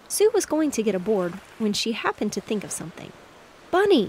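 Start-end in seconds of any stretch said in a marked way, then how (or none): 3.7-4.1 high, loud call of a name; a girl calling to her brother. A narrator's reading. Girl calls brother by name, loud. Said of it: "she called".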